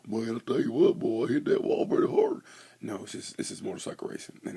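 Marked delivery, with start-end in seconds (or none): 0.1-2.4 loud hammed-up Southern good ol' boy; mock Southern drawl. Nascar driver after hitting the wall, mock Southern accent. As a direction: ham up a Southern racer, loud and high. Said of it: "It's not like nascar where they crash".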